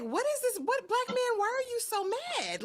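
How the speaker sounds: very high-pitched baffled questions; exasperated disbelief. Retold or imitated. Viewer asks the man why he is mad, squeaky and exasperated. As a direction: act out bewildered protest, way up high.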